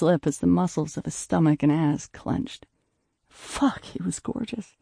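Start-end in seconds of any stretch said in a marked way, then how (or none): 3.3-4.8 unvoiced, breathy whisper of desire; breathless whisper. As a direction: whisper it under your breath. Breathless inner exclamation.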